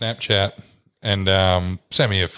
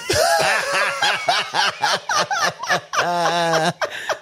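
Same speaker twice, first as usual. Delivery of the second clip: big high burst of laughter; 12 semitones higher than usual. Real, not acted.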